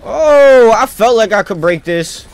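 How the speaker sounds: loud, high, stretched-out burst of excitement; real hype yell; excited yell. Real, not acted. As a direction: shout it out as the move lands. Sudden excited outburst in game.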